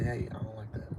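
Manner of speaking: near-whispered calm pushback; calm quiet tone. Shown as a demonstration. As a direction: set the boundary gently and quietly. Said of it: "I'm gonna say it in a certain tone".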